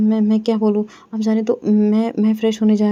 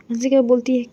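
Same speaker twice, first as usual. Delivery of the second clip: plain reading voice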